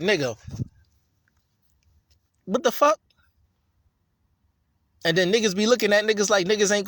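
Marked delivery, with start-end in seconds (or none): 2.4-3.0 high, incredulous what the; a real shocked outburst; stunned. Real, not acted. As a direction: disbelief at the story, voice jumping up. Outburst after the reading.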